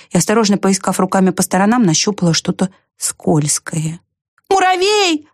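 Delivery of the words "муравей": high-pitched cry for help; high, ringing call. A narrator's reading. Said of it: "закричала она".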